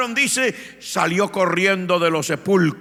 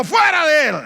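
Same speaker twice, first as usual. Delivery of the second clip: high, loud exorcising command; Jesus ordering the demons out; 11 semitones higher, 7 dB louder than usual. Retold or imitated. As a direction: cast the demons out with authority.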